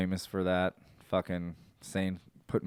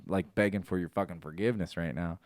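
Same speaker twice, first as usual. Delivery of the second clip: ordinary talk, nothing marked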